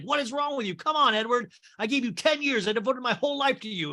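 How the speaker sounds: high-pitched, exasperated appeal; indignant pleading. Acted. [name] pleading in acted quarrel.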